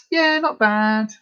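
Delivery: a drawn-out, reluctant "Yeah, not bad."; slow forced reply. Answering a friend's question about Christmas, slow and reluctant. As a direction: drag it out like a polite lie. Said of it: "But what you say is".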